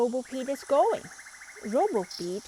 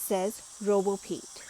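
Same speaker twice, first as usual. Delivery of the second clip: ordinary delivery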